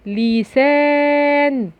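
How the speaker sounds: "لسان" with a long drawn-out "سا"; slow demo reading. Shown as a demonstration. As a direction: stretch out "لسان" for the kids. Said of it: "فيها مد بالألف".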